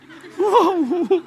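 high, loud burst of laughter. Real, not acted. Preacher laughing at the boy slipping through the fence. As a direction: laugh out loud at your own story.

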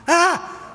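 very high laughing yelp; a sudden high scream at the rat; startled shriek. Retold or imitated. Spotting a rat in the parrot cage, high startled shriek. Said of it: "And I'm like".

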